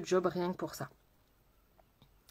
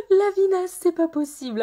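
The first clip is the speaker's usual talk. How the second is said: loud, high, laughing cry of disgust; mock horror at a viewer's joke; playful outburst; 10 semitones higher, 9 dB louder than usual. Real, not acted. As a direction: throw it out with a laugh. Reacting to a viewer's comment.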